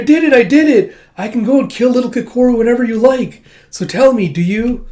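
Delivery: put-on very high, loud little-girl squeal; excited, triumphant child. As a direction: burst out proudly, begging for approval. Child triumphantly seeks father's love.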